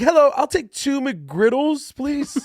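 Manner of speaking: high, slow, sweet drive-thru voice; rising pitch. Shown as a demonstration. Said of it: "a little higher pitch voice".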